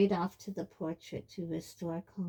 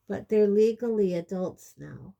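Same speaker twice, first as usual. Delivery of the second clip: tired voice; 2 semitones higher, 10 dB louder than usual. A narrator's reading. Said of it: "in the same tired voice".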